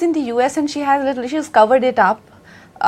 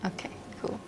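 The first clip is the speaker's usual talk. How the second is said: ordinary talk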